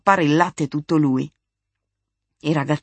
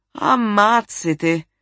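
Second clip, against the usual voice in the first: higher, slow drawn-out exclamation; impatience turning good-humoured; 5 semitones higher, 4 dB louder, much slower than usual. A narrator's reading. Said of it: "aggiunse cambiando l'impazienza in buon umore". (esclamazione lenta, tra impazienza e buonumore)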